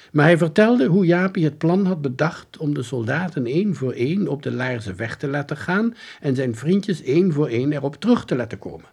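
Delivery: ordinary delivery, nothing marked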